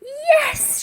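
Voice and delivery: very high joyful yes; triumphant shout. Acted. Elsie cheering as Woolly is saved. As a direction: cheer the victory. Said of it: "shouted Elsie".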